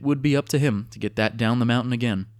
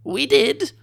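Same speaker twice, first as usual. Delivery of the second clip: much higher squeaky confession; admitting kobold; 4 dB louder than usual. A narrator's reading. Kobold chief admitting the killings, high and sheepish. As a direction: own up in a tiny lizard voice. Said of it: "admitted Ndok".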